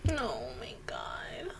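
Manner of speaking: breathless whisper of shock; stunned whisper. Real, not acted. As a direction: whisper it, overwhelmed by the scene. Reacting to sad scene.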